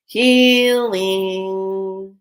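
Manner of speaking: loud, long-held sing-out; drawn-out announcement. Real, not acted. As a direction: stretch the word out like a big reveal. Drawn-out reveal of the card theme.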